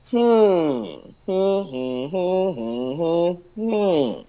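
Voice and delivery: slow, high hum stretching each note; long, separate melodic hums; slowed-down humming. Shown as a demonstration. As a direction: draw out the hummed intonation note by note. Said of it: "you slow it down".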